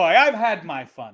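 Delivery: slow, high posh Englishman; hammy pompous gent; posh British voice. Pretend. Posh player voice.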